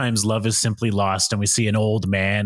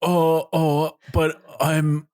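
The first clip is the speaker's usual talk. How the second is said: Kate Winslet; 2 semitones higher, slower than usual. Acted. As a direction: plead haltingly, as a hammed-up lovesick heroine. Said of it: "you be Kate Winslet".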